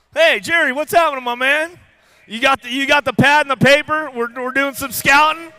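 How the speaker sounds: big shout-out to a buddy; hyped shout-out. Real, not acted. Announcer hyping up team manager Jerry, loud and playful.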